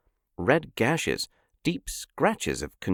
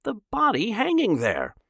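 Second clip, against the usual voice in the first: shouting; 13 semitones higher than usual. A narrator's reading. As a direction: insist the crowd look. Ed shouting at crowd.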